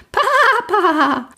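very high, drawn-out excited shout; joyful call. A narrator's reading. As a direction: squeal for dad like a foal. Foal calls out to father. (hoch und begeistert rufen)

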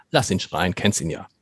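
low, soft, unimpressed aside; resigned dismissal. Retold or imitated. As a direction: imitate people who have stopped caring, voice dropped. Voices listeners no longer impressed by shouting.

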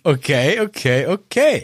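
pondering each Okay at half speed; musing okays; slow, thoughtful okay. Acted. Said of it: "die Betonung macht's".

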